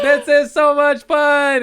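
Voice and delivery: put-on very high, loud, delighted kid; squeaky joyful kid; little kid voice. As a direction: squeal it like a thrilled toddler. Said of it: "The first words out of his mouth".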